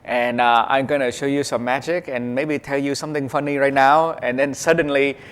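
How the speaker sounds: put-on loud, thick Vietnamese accent in broken English. Comedian showing his stage introduction, put-on Vietnamese accent. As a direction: keep up the fake Vietnamese accent, loudly. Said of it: "this is my real accent".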